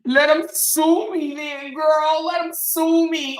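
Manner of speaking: high, drawn-out taunt; mocking dare. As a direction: goad them in an exaggerated high voice. Mockingly daring a threatened lawsuit.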